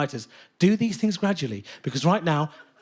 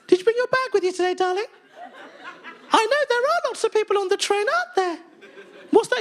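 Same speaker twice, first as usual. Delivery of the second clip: put-on high sing-song doting parent talking to a toddler; performance parent; 14 semitones higher than usual. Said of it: "in this really weird tone".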